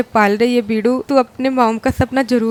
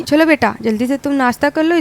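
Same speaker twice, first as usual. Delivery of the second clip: ordinary talk, nothing marked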